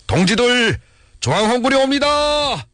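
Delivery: high-pitched calling-out across a distance; shouted announcement. A narrator's reading. Lookout calling to comrades that the army comes, shouting. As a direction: cry the news out with long final syllables. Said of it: "누군가 소리를 쳤다".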